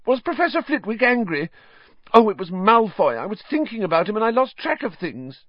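a worried schoolgirl explaining herself; voiced high as a fretful young witch; Hermione, flustered.

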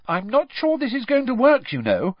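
high, cautioning voice; warning. A narrator's reading. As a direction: warn them off, worried. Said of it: "said Hermione warningly".

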